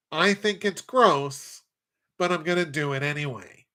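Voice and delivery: nasal voice with nose pinched shut; nose plugged. Acted. Picking the Patriots against the spread, said holding his nose. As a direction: talk holding your nose closed. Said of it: "That's my actual nasally voice when I plug my nose".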